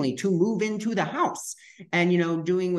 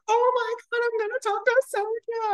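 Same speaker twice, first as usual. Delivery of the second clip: very high-pitched gushing; retold giddy, high-voiced excitement; excited squeal. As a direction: squeal it like a thrilled fan. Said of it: "I was so excited".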